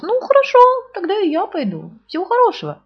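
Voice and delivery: a high, cheerful little bear; Pooh voice. Acted. Pooh takes his leave, high bear voice. Pooh's voice.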